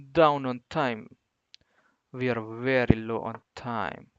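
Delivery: mock Russian accent, deliberate and heavy on each word. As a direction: put on a playful Russian accent. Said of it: "I don't know why I'm talking like a Russian".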